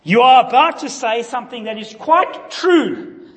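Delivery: high, loud demon declaration. Retold or imitated. Preacher voicing demons naming Jesus loudly. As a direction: blurt it like a demon. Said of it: "Jesus muzzles them".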